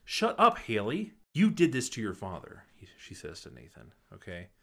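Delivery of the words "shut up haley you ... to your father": sudden loud furious yell; angry shout. Retold or imitated. Deb yelling at Haley and Nathan, loud and furious. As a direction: snap at them loudly.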